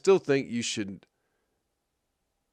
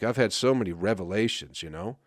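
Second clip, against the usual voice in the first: normal talking voice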